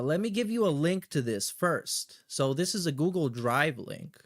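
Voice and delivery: ordinary delivery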